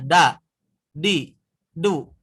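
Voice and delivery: "Da, di, du" with the tongue on the palate; wrong place. Shown as a demonstration. Teacher showing the wrong placement, loud da. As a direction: say "Da" from the roof of the mouth. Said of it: "itu kemana nempelnya".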